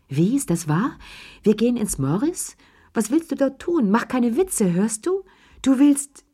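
agitated, high-pitched string of incredulous questions; losing self-control. A narrator's reading. Desmond challenging his friend about the hotel, losing self-control. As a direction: let the pitch climb as composure goes. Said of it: "Desmond verlor seine Selbstbeherrschung".

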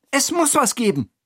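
high, urgent character voice; insistent call. Acted. Hira insists on a solution, high and urgent. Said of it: "ruft Hira".

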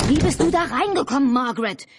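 high, loud frantic yell; yelled demand; shouting. A narrator's reading. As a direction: shout the question, pitch way up. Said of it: "schrie Albert".

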